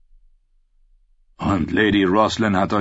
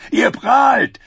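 loud, high jeering shout; mocking shout; 6 dB louder than usual. A narrator's reading. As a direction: call out his bragging, laughing. Mocking a boastful knight.

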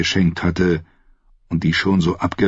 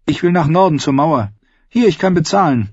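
put-on lightly raised girl's voice, eager; young girl Arya; 9 semitones higher than usual. Arya asking the captain for passage, eager girl's voice. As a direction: plead your case like a determined kid.

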